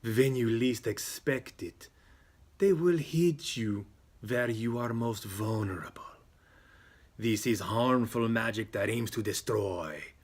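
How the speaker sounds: deliberate mystic fortune-teller voice; theatrical seer warning of dark magic; ominous psychic. Acted. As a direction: intone the dire reading slowly.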